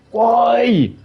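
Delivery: high, loud single why; exasperated why. Real, not acted. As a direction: throw the why up in disbelief. Exasperated rant at Capcom.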